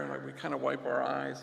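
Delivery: ordinary talk, nothing marked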